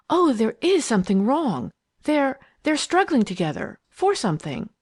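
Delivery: high, swooping alarm; half-suppressed cry. A narrator's reading. Leslie seeing the struggle outside, half-suppressed cry of alarm. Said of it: "uttered a half-suppressed cry".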